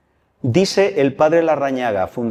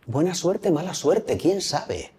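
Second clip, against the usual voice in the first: softer, let the moral land quietly; hushed moral; 3 semitones higher, 6 dB quieter, slower than usual. Real, not acted. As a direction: say it softly, like a secret truth. Moraleja dicha en voz baja. (en voz baja, dejando caer la moraleja)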